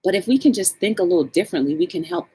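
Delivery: ordinary delivery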